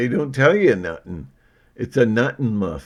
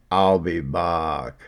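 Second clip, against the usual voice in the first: slow, badly faked movie-catchphrase voice; drawn-out, put-on catchphrase delivery; terrible impersonation; 3 semitones lower, slower than usual. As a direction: do a slow, bad impression of a film line. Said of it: "that was a terrible".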